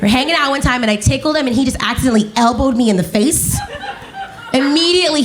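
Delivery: ordinary talk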